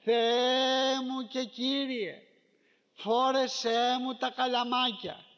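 slow, loud imploring prayer; drawn-out earnest prayer. Retold or imitated. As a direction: plead slowly to God. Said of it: "στην προσευχούλα σου θα λες".

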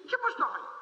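high, amazed mock disbelief with a laugh; incredulous question. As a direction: act stunned, laughing at the fool. (έκπληκτη ερώτηση με γέλιο)

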